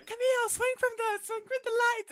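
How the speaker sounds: very high squeaky little-rat voice; tiny shrill rodent voice. Retold or imitated. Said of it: "they're like".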